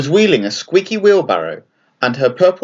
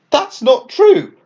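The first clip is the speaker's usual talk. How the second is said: much higher, slow protesting cry; 13 semitones higher, 4 dB louder, slower than usual. Acted. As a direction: cry out against the accusation. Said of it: "Franklin cried".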